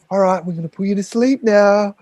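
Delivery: eerie nurse voice. Retold or imitated. Nurse tells the patient he'll be put to sleep, lightly eerie. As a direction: retell the nurse's calm words with a slight creepy edge. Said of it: "In this eerie voice".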